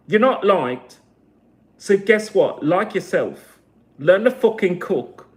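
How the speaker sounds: loud, emphatic tough-love scolding; heated, punchy rallying rant; angry exhortation. Real, not acted. Angry exhortation to own community.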